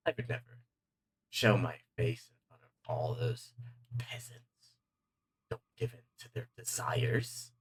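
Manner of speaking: hushed, partly whispered villain scorn; scornful demon. Acted. Demon scoffing at the sheriff, half whispered. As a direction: sneer at the peasants under your breath.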